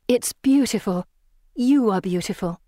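put-on girlish character voice. A narrator's reading. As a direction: call out sweetly as a young girl. Pippa's voice.

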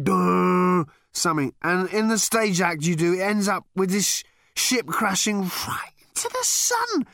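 high, slow, loud tipsy voice; slurry drunken bonhomie; drunk Ford. A narrator's reading. Drunk Ford recalls the act.

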